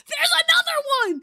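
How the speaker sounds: high, loud squeal of delight; excited shriek. Real, not acted. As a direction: shriek as a new otter pops up. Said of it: "This is so exciting".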